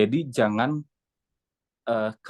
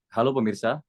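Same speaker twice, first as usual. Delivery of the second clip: anchor greeting in an easy low register; lower register. Shown as a demonstration. Coach shows trainee the tone to use, low register. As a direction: keep the greeting down, unforced. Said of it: "coba Mas Muslimin pakai nada bawah".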